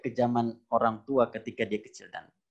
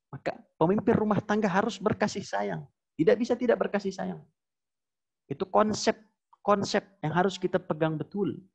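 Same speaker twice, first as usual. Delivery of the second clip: impassioned preaching; 9 semitones higher than usual. Acted. Preaching at full force.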